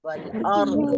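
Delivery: वल अर्द with a thick final dad; heavy dad. Shown as a demonstration. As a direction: land hard on अर्द. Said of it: "दौद होता है ना".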